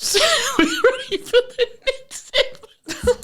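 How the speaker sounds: high, laughing, near tears; squeezing words out through laughter; after laughing hard. Real, not acted. Laughing almost crying.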